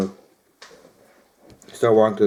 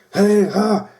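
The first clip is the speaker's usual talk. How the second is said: high-pitched mock holler; imitated yelling; 11 semitones higher, 3 dB louder than usual. Storyteller imitating friend yelling at the group, high strained holler. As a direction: holler it like a friend across the road. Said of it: "he's pointing and he's yelling".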